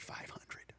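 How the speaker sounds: unvoiced whispered aside. Seller whispering the real price to himself. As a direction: breathe it like a secret tip.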